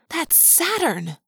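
high, drawn-out amazement; astonished gasp. A narrator's reading. Lauren recognizes the sixth planet, gasping in amazement. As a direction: marvel at it, pitch soaring. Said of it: "Lauren gasped".

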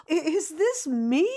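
high, wondering voice; surprised by your own sound; amazed young self. Retold or imitated. Young singer amazed at her own voice, high and wondering.

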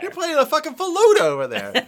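high, loud, laughing, saying "faluda" for flute; laughing mispronunciation. Real, not acted. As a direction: crack up while saying "faluda". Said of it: "What the fuck is a faluda?".

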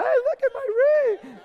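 high squealing show-off voice; gushing fiancée. Retold or imitated. Fiancée showing off her ring at party, high squeal. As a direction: squeal over the new ring. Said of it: "And then she goes".

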